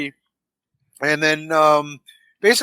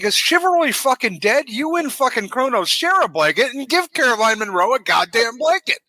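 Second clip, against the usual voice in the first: high-pitched, indignant comic rant; mock outrage; 9 semitones higher than usual. Viewer berating film characters over the blanket, mock outrage. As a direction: scold the film's heroes in exasperation.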